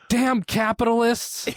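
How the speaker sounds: high, drawn-out cry of blame; hammed-up indignant complaint; mock outrage. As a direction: wail it like a cartoon victim. Cartoon rider blames others after crash.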